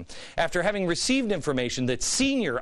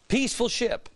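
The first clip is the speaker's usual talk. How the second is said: sarcastic; 7 semitones higher than usual. Real, not acted. Mocking label, pitch up.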